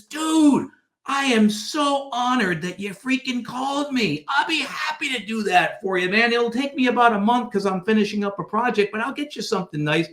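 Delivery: high-pitched, excited retelling of an honored friend; thrilled yes. Retold or imitated. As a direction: play him eagerly honored, pitched well up. Acts out delighted author saying yes.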